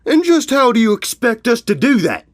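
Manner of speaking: loud, high-pitched indignant bark; barked challenge. Acted. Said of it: "Ironhoof barked".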